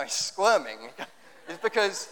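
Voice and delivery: usual speaking voice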